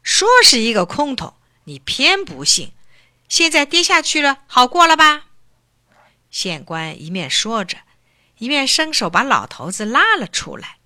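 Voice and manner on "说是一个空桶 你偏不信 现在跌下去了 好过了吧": louder, scolding the father; angry scolding. A narrator's reading. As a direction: magistrate snapping at his father. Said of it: "县官生气地说". (县官生气地数落)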